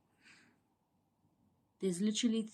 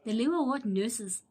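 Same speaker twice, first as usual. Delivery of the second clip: ordinary talk, nothing marked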